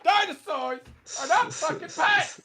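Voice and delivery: high, loud mock fury; mock outraged rant. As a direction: rant at them, barely holding a laugh. Shopkeeper rant about dinosaurs.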